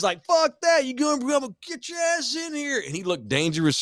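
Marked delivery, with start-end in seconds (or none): 0.2-2.8 insistent. Retold or imitated. Mark insists he come in.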